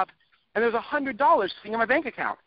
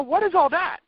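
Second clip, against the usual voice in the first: incredulous; 9 semitones higher than usual. Real, not acted. Mocking the complaints he listed.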